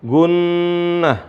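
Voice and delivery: GUNNAH with a long hummed n; ghunnah. Shown as a demonstration. Teacher recalling the ghunnah rule, lingering on "gunnah". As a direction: hold the nn in "gunnah" before releasing. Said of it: "ada gunnah".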